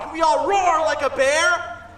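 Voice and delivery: ordinary delivery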